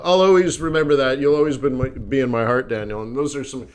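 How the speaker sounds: normal talking voice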